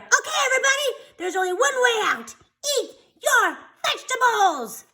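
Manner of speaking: put-on rally the kids like a leader; calling out. A narrator's reading. Sammy to all the kids, calling out high. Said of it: "Sammy cried out".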